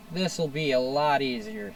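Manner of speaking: ordinary delivery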